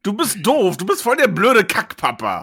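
put-on bratty teen tantrum. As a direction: snap at dad like a teen. Teen son insults father.

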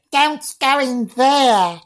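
slow, very high mock girl; young girl's voice. Shown as a demonstration. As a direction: draw it out as a ghostly little girl. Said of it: "I can say it in a girl".

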